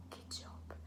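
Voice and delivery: soft unvoiced whisper. Shown as a demonstration. Teacher whispering praise in the whisper round. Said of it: "in a whisper voice".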